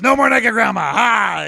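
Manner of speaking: loud proud grandpa yell; triumphant shout. Retold or imitated. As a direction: bellow it with pride. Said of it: "And he goes".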